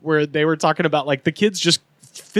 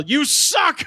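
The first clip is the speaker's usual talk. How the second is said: high-pitched mock yell, no real volume; 9 semitones higher than usual. Dad from the other team at an eight-year-old, mock yell. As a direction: act out a dad's yell. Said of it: "come up to me and yell".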